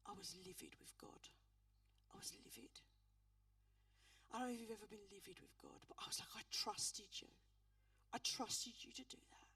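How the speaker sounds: whispered, barely voiced anger at God; hushed whisper. Real, not acted. Grieving minister whispering her rage at God. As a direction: let the fury come out as a raw whisper. Retelling fury at God after death.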